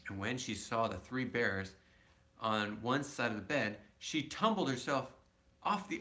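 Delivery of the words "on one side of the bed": ordinary delivery, nothing marked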